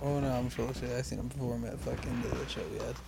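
normal talking voice

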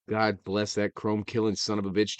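normal talking voice

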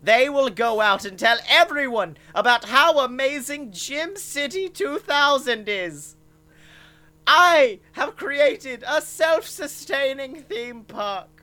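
high gloating evil-genius voice; gloating mad scientist. Acted. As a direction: crow over the evil plan. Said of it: "And he's like".

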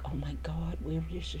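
panicked unvoiced whisper. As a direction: hush it out in panic.

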